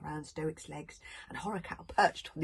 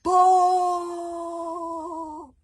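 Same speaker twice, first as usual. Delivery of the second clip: long, high, loud horn blast; horn call; 13 semitones higher, 13 dB louder than usual. A narrator's reading. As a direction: imitate a mournful horn. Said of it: "sounded a mournful signal on his horn".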